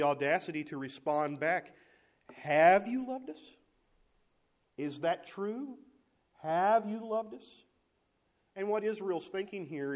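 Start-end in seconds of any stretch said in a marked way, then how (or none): 2.3-7.6 slow, high and loud, drawn-out disbelief; indignant challenge. Acted. Israel challenging God, slow incredulous higher louder voice. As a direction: throw the question back in disbelief.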